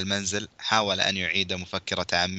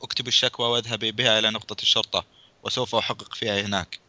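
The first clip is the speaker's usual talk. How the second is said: ordinary delivery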